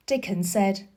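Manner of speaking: ordinary delivery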